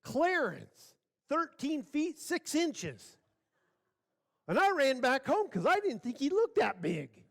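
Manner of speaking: high-pitched, hammed-up alarm; comic panic. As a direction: blurt it like a spooked coward. Trembling Rufus explains his panicked retreat.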